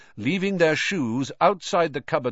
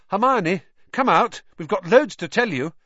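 calling out; 9 semitones higher, 5 dB louder than usual. A narrator's reading. Ron hammering on cubicle door.

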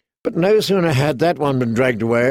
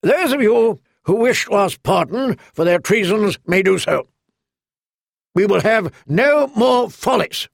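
clear, strong voice; 7 semitones higher than usual. A narrator's reading. Lord takes command of the hall, clear strong voice. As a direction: silence the room with authority. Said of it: "in a clear, strong voice".

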